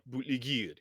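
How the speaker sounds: bootlegger garbled in a mock French accent; French-accented "bootlegger", last syllable twisted to a long "ee". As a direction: imitate a French sailor mangling "bootlegger". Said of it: "the French said something that sounded like".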